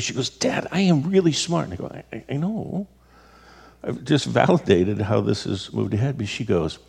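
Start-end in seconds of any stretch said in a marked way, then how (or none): 0.3-1.7 mock-exasperated teen insisting, a touch higher; frustrated daughter. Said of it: "She'd be so frustrated". 1.9-2.9 stammering, higher and softer, backing down; dad caving to his daughter, hesitant and hushed; sheepish agreement. Retold or imitated.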